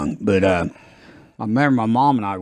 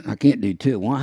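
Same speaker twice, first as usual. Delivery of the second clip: ordinary talk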